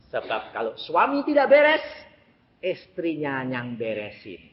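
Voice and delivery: normal talking voice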